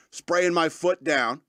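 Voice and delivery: ordinary talk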